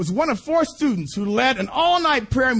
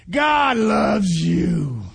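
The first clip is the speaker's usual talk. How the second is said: slow, louder, stretched declaration; emphatic proclamation; 4 dB louder, much slower than usual. Real, not acted. Preacher tells the congregation God loves them, slow and loud. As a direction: proclaim it slowly to the crowd. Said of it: "preacher's slow emphatic proclamation".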